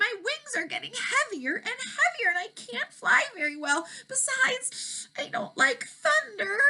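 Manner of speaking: a high, whimpering dragon close to tears; a worn-out dragon pleading, voice cracking toward a cry; weary dragon. Acted. Dragon in the storm tells Elmer he can't fly, whimpering.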